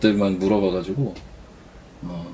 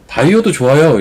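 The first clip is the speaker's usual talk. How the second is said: self quote; 4 semitones higher, 6 dB louder than usual. Retold or imitated. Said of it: "이래요".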